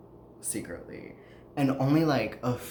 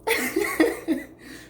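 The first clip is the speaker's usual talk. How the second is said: laugh; 4 dB louder than usual. Real, not acted. Host laughing at her own ramble about the article, bright giggle. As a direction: burst of high giggling.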